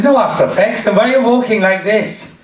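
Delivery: mock Indian doctor; Indian accent. Doctor puzzled by the patient's walk, Indian accent. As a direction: challenge in a put-on Indian accent. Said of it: "He was an Indian doctor".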